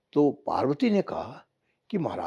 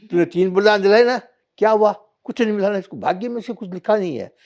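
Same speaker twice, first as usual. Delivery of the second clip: scolding god; 7 semitones higher, 6 dB louder than usual. Acted. Shiva scolds Parvati.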